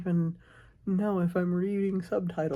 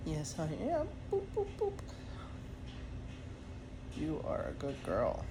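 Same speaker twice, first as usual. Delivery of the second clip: softly cooing, lilting higher; soft pet talk; 4 semitones higher, 7 dB quieter than usual. Real, not acted. Owner asks pet if she wants neck scratches, soft cooing. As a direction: coo gently to a beloved pet.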